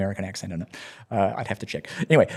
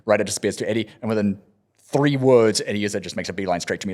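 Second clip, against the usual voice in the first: ordinary delivery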